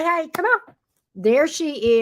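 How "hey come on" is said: high coaxing call. Pretend. Voicing greeting for dog.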